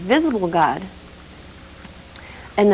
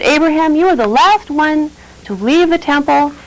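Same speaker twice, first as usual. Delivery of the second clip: accusing priest; 7 semitones higher, 4 dB louder than usual. Acted. Priest confronts Abraham.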